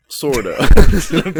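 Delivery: high, loud, laughing aside; giggling tag-on. Real, not acted. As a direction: crack up on the word. Comic coda, laughing.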